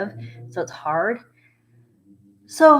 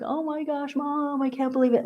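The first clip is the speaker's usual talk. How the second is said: high, tearful retelling of bawling; mock bawling; 8 semitones higher than usual. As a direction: imitate yourself wailing to mom in a high voice. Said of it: "I was bawling. I'm like".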